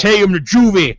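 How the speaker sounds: high, loud put-on cop voice; imitated cop. Cop wanting to haul boys in, loud imitation. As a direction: play up the indignant officer.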